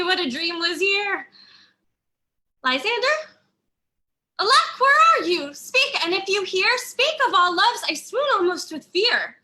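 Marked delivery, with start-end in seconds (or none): 2.6-3.3 calling out higher and louder, rising; worried searching call; anxious call. Acted. Searching for absent Lysander. 4.4-9.4 high, loud, fearful calling out; panicked calls to vanished Lysander; frightened calling. Acted. Calling for missing Lysander in fear.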